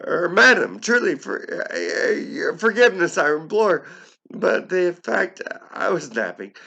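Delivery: high, loud pleading address; high, loud mock-apologetic character voice. Speaker addresses the visitor.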